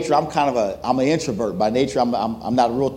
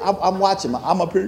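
ordinary talk, nothing marked

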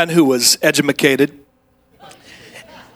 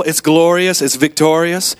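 ordinary talk, nothing marked

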